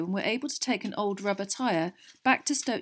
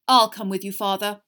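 self-important; 9 dB louder than usual. A narrator's reading. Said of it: "said Percy importantly".

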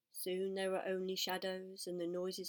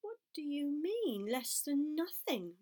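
puzzled piglet voice; 6 semitones higher, 3 dB louder, slower than usual. Acted. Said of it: "replied Wilbur".

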